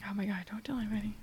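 hushed whisper, like sharing a secret. Real, not acted. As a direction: whisper it so nobody hears. Secret kept from listeners.

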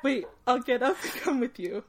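words shaken by laughter; laughing while reading. Real, not acted. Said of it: "[name] asks".

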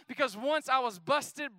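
ordinary talk

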